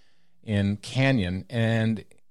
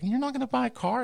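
much higher, mock disbelieving dad; dad's incredulous quote; faster than usual. Retelling his dad scoffing at a kid's car plan, mock disbelief. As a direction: echo dad's disbelief up high. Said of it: "He's like".

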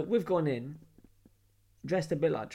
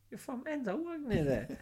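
high-pitched, softer mock excitement; excited recognition; 9 semitones higher, 4 dB quieter than usual. Londoner meeting a bouncer from near home, high mock excitement. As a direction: squeal it lightly, finding a local abroad.

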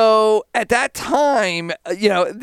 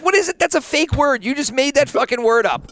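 high-pitched, laughing disbelief; incredulous disbelief; 6 semitones higher than usual. Retold or imitated. Host recalls scoffing at his cohost's new word, high and incredulous. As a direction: act baffled by a made-up word, pitch up high. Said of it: "I'm like".

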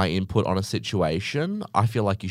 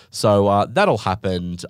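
usual speaking voice

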